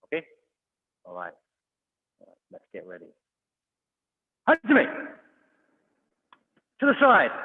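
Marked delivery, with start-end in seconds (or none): 4.5-5.2 high, loud shouted start; shouted command. Real, not acted. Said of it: "I'll point and I'll shout". 6.8-7.3 high, loud called direction; shouted direction. Real, not acted. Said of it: "I'll point and I'll shout".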